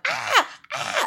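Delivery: very high mock scream. Host imitating the campers screaming in terror, high mock scream. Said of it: "they were all like".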